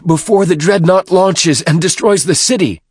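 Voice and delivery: high, loud warning; urgent shout. A narrator's reading. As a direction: shout it out as an alarmed order. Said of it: "Fortitude shouted".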